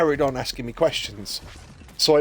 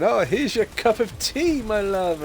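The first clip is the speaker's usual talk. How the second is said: a higher, slower, syrupy sweet partner voice; mock sweet partner; 6 semitones higher, slower than usual. Partner handing over the tea, a higher, slow mock-sweet coo. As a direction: coo it sweetly and higher, like a too-nice poisoner.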